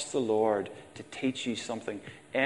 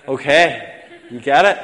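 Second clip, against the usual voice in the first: much louder, pleased "you get it?"; jovial loud aside; loud check-in; 4 semitones higher, 12 dB louder than usual. Real, not acted. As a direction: prod the crowd after the joke. After the joke punchline.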